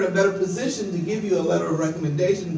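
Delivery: ordinary talk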